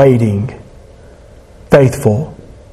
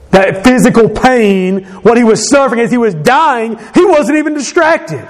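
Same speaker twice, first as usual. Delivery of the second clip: rising intensity; 8 semitones higher than usual. Real, not acted. Preacher rising to a peak.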